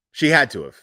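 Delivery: normal talking voice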